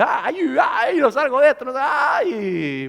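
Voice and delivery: high, loud anguished cry of someone trapped; desperate wail. Acted. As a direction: wail it out as if you can't escape the storm. Preacher acts someone caught in a storm. (lamento desesperado y gritado)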